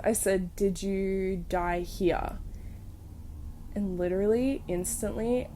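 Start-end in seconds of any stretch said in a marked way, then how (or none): 0.5-2.4 slow, hushed question to a ghost; slow careful question. Retold or imitated. Asking the spirit a question, slow and hushed. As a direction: ask it slowly into the empty room.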